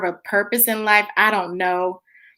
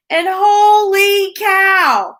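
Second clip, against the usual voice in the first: holy cow surprise; 7 semitones higher, 5 dB louder, much slower than usual. Real, not acted. Realizing she is late.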